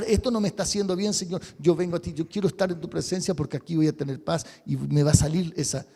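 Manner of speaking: ordinary talk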